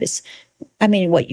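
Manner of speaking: ordinary talk, nothing marked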